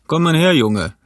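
hearty summons in character; calling out. A narrator's reading. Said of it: "rief Silver".

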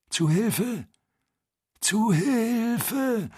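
high, drawn-out plea, weaker; plaintive call for help. Acted. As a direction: wail it, fading and pleading. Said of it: "ebenso klagend, aber schwächer". (klagender, schwächerer Hilferuf)

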